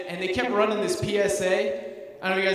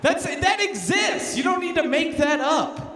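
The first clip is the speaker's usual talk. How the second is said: exasperated shout; 6 semitones higher than usual. Real, not acted. Comic to audience about leprechaun uncle, exasperated shout. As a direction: shout it out in disbelief.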